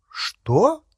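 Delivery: high, rising startled question; surprised. A narrator's reading. Hermione caught out by the goblin's remark, surprised. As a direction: jump up in pitch, taken aback. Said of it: "удивилась Гермиона".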